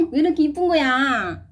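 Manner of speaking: sharp gasp, then a loud high gush; gushing delight. Real, not acted. Gushing over her dolls, loud and high. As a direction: burst out adoring them. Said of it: "애교 장난 아니게".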